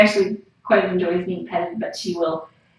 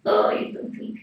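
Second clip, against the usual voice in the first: much higher, breathy-free whisper of praise; high whispered coo; 12 semitones higher than usual. Real, not acted. To the sweet cat, a high whispered coo.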